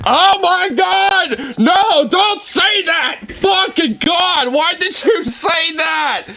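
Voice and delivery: high, slow, stretched-out shouts of disgust; horrified shout. Real, not acted. Reacting to the frisky grandpa clip, horrified drawn-out yelling. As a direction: yell out in real horror, dragging each word.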